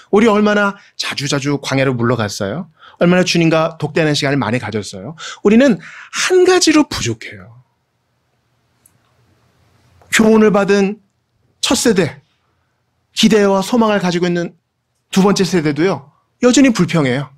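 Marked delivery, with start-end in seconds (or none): 5.4-17.3 preaching at full force. Acted. Rising preaching.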